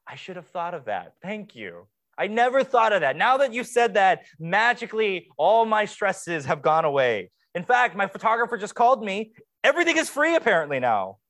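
deadpan then rising, fast mock gratitude; mock the advice with overdone thanks; sarcastic thanks. Bride sarcastically thanking people for advice, in a fast, rising mock-grateful tone. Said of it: "I say".

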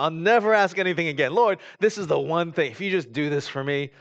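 high, loud eager pleading; imitated desperate bargain with God; bargaining prayer. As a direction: promise God anything, loudly.

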